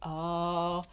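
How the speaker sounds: usual speaking voice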